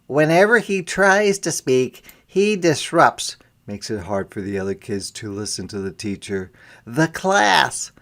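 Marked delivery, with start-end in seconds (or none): 0.0-3.4 higher, louder, officious teacher's excuse; teachers explaining. A narrator's reading. Teachers telling the parents why the boy is removed, higher and louder. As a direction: lay out the complaint firmly. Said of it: "the teachers answer".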